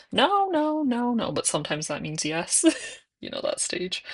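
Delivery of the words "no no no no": put-on high, babyish repeated no; toddler saying no. Mom imitating her toddler refusing bed, high babyish no. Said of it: "I think he says no".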